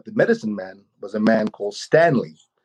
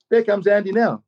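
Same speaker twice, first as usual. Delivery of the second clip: raised, lightly voiced mother announcing; voicing Mum; 9 semitones higher than usual. Mum at the window announcing her brother's arrival, lightly voiced. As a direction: lift into Mum's voice, a little higher.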